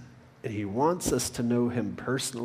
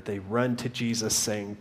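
ordinary talk, nothing marked

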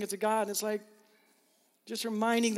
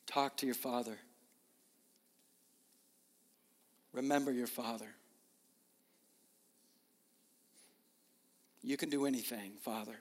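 closing prayer; 3 semitones lower than usual. Real, not acted. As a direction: lead the room gently into prayer.

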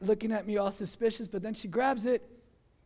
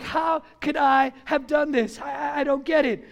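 acted guilty disbelief; 5 semitones higher, 5 dB louder than usual. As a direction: stammer in mock horror at yourself. Acting out his guilty disbelief.

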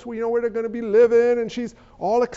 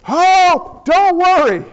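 breezy angel; 7 semitones higher, 4 dB louder, slower than usual. Acted. Gabriel brushes off Mary's worry.

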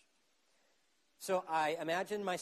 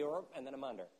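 ordinary delivery

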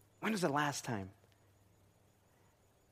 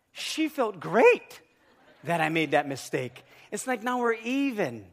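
ordinary talk

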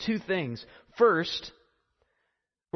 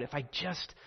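soft inward murmur, repeating the hope; hushed, pleading self-talk; murmured to herself; 3 semitones lower, 8 dB quieter than usual. Retold or imitated. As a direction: say it under your breath to yourself. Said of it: "she kept saying to herself".

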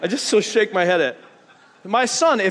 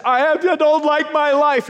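higher, louder whine; mock whiny complaint; 7 semitones higher, 5 dB louder than usual. Believer complaining to God, mock whiny. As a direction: play the ungrateful believer. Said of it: "We say".

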